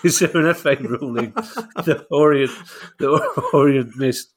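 laughter bubbling through the reading; barely holding back a giggle; laughing. Real, not acted. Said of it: "I'm sorry for laughing".

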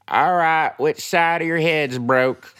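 high, loud country twang; blunt country EMT asking straight out; loud, high Okie twang; Oklahoma EMT. Retold or imitated. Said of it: "and she said".

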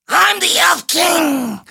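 high, slow, shouted elf voice; Elf King. Acted. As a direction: squeal it like a little king. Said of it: "who sounds like Percy Helton".

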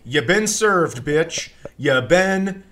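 loud, slow gloating chant; gleeful taunt. Retold or imitated. Friends at the table taunting the guys, a loud gleeful gloat. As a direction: rub the burn in loudly, savoring each word. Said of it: "I started laughing".

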